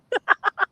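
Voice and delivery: short high laugh; a burst of high laughter. Real, not acted. Laugh after story.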